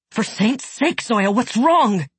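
high, desperate and worried; alarmed plea. Acted. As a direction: beg her to tell you. Zoya wailing in anguish.

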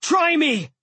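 high, loud defiant shout; roared. A narrator's reading. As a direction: dare him to attack. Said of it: "Blitz roared".

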